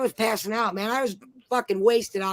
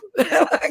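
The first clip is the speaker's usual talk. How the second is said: burst of laughter; 6 semitones higher, 5 dB louder than usual. Real, not acted. Laughing at the punchline.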